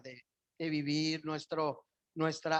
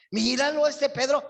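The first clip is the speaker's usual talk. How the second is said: mock judging; 10 semitones higher, 9 dB louder than usual. Mocking Peter while pointing fingers.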